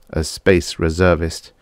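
usual speaking voice

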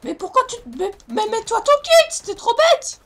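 loud exasperated yell. Real, not acted. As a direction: bark orders at a teammate. Yelling instructions at teammate.

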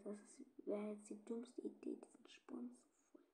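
soft voiceless whisper with a gasp; frightened whisper. Real, not acted. Player creeping onto the roof alone, frightened whisper. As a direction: whisper with your heart pounding. Sneaking on the roof, heart pounding.

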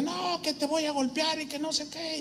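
half-hearted mimic of the thug; mock threat. Retold threat of attacker. (amenaza imitada, más aguda)

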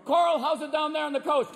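high, projected anchor voice; TV weather anchor. Acted. Anchor checking on reporter out on the coast, calling out. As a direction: call out to a reporter in the field. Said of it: "they always go down to some poor fuckers".

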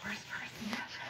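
low-voiced, whispered question back; hushed echo. Eavesdropper picks up on the worst person remark, hushed. Overhears gossip about the ex, echoes it.

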